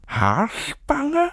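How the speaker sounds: high, rising puzzled echo; puzzled question. A narrator's reading. Old woman asks Anne what she means, puzzled. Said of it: "Frau Rosa blickte fragend".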